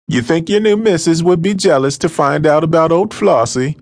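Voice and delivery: put-on high, loud, challenging old woman's voice; drunken Flossie challenges the gentleman about his new wife; demanding drunk woman. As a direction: hands on hips, demand an answer. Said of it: "the woman demanded".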